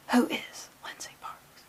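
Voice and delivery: slow hushed whisper, mock dread; mock existential whisper. Reader mock-questions her own identity, slow whisper. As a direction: breathe it like a lost soul. Identity crisis over liking Jubilee.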